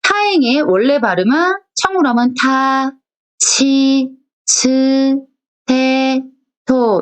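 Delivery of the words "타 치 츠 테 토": 타, 치, 츠, 테, 토 read crisp and apart; voiceless ta row. Shown as a demonstration. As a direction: model 타 치 츠 테 토. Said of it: "이렇게 발음을 하는데".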